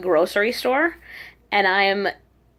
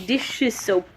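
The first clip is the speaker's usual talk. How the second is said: mimicked Korean accent, careful syllables. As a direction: put on a Korean accent for a shop clerk. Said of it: "saying in a Korean accent".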